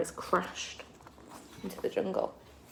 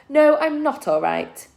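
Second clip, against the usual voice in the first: louder, higher bitter snap; spat retort; 8 semitones higher, 6 dB louder than usual. Acted. Con snapping back at Fred about her wound, bitter and louder. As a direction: spit the words back at him. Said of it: "Con spat".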